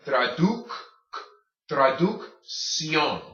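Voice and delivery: traducción built up slowly, first C as K, second as S; slow syllable build-up. Shown as a demonstration. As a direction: sound out traducción piece by piece, slowly. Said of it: "first C is soft, is hard".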